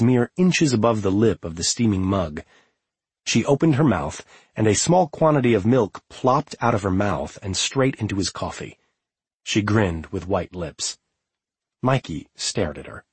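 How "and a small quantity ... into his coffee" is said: ordinary delivery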